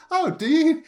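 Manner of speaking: sudden high, loud "oh, do you"; surprised interruption. Retold or imitated. Sister butting in on the customer, high and eager.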